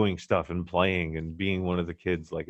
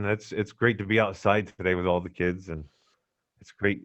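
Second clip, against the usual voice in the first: normal talking voice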